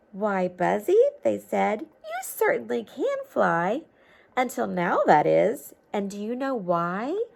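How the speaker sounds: lively storybook read-aloud; reading to children. A narrator's reading. Teacher reading a storybook to children, lively read-aloud. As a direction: read it like a picture book to kids.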